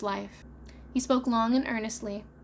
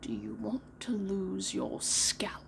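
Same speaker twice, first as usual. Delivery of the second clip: menacing whisper; 5 dB quieter, slower than usual. Acted. Hook warns Smee.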